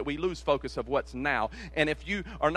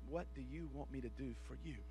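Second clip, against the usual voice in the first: soft tender question; 3 semitones lower, 16 dB quieter than usual. Acted. Quoting the servant's question.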